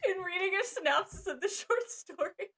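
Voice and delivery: high, helpless real laughter through the words; barely talking through laughter; laughing hard. Real, not acted. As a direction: struggle to get it out while cracking up. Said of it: "Sorry, I'm laughing so hard".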